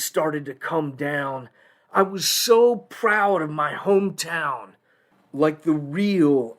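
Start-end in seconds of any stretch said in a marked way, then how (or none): none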